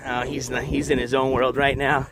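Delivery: usual speaking voice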